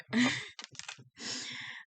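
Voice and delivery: breathy little laugh at herself; embarrassed laugh. Real, not acted. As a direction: laugh off the slip. Stumbling over words, laughs.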